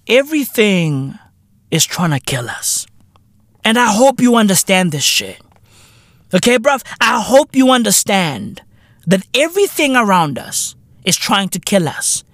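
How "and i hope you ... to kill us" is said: ordinary talk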